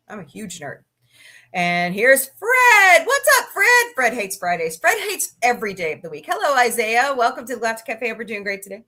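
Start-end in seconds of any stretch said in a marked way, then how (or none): 1.5-3.9 loud, drawn-out build to a very high greeting; show-host announcement. Pretend. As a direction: hail a guest like an excited announcer. Announces a viewer's arrival like showman.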